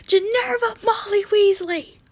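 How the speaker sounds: high-pitched yell of a full name. A narrator's reading. Harry banging on Ginny's door, yelling. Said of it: "I yelled".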